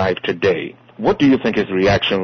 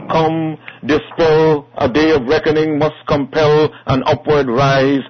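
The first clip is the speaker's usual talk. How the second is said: raised, ringing declamation with long pauses; poem recital; 7 semitones higher, 3 dB louder than usual. Acted. Host reads a tribute poem on air, declaiming it. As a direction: proclaim each line like a rallying call to revolution. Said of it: "share ... a poem".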